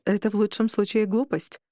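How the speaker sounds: ordinary delivery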